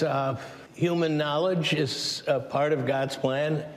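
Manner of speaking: usual speaking voice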